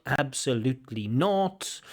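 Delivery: ordinary talk, nothing marked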